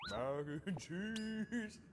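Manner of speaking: drawn out and grand; dramatic slow announcement. Acted. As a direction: announce it like a movie trailer.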